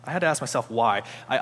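ordinary talk

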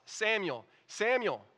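high, loud double call. Acted. The Lord stood calling Samuel twice, calling out. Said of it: "calling as at other times".

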